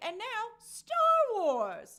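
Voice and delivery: big, loud, drawn-out proclamation; mock announcer. Host mimics Lucasfilm unveiling the franchise, mock grand announcement. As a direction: announce it high and slow, stretched for mock grandeur. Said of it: "Lucasfilm was like".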